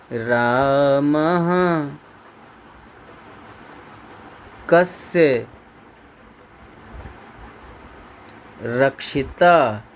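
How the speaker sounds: each word drawn out with long pauses between; slow dictation. Shown as a demonstration. Teacher reading the question aloud for students to copy, slowly and drawn out. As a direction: read it word by word as the class copies it down. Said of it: "यहाँ देखिएगा लिखा है".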